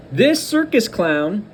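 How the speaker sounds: usual speaking voice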